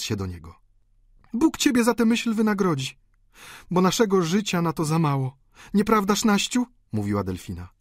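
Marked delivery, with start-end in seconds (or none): 1.3-6.7 high, fervent woman's voice; high-pitched heartfelt appeal; grateful daughter. A narrator's reading. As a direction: thank your father fervently in a high voice. Delfina voiced in the story.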